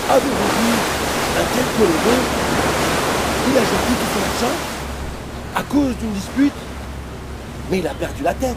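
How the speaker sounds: high, loud shocked exclamations; horrified sailors. Acted. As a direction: gasp in outrage at the madman.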